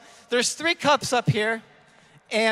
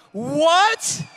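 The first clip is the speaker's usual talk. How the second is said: shocked "what"; 5 semitones higher, 4 dB louder than usual. Acted. Winner sees Hawaii tickets.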